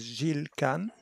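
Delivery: "Gilles Kahn" said the French way, "zheel kan"; French pronunciation. Shown as a demonstration. Guest correcting the host on a name, "Gilles Kahn" in French pronunciation. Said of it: "the French pronunciation is".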